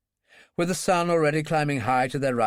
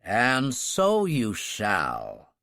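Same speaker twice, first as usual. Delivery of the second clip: slow, indulgent, in a lower voice; 3 semitones lower, much slower than usual. Acted. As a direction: grant it like a generous host, slowly. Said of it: "the President said indulgently".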